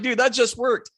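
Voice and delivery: high-pitched excited exclamation; thrilled disbelief. Retold or imitated. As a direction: gush with delight that the plan worked. The spike moved as planned.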